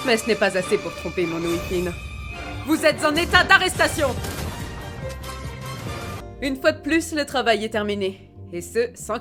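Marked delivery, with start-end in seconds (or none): 2.7-4.1 high, raised-voice police announcement; commanding, high-pitched declaration; called-out arrest. Acted. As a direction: call out the arrest with authority.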